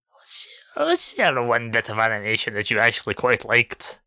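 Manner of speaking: hammed-up Irish lilt on a simple question; Irish accent. Co-host asks his question in a mock Irish accent. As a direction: put on a jokey Irish accent to ask the co-host. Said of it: "overtaken by the power of a leprechaun".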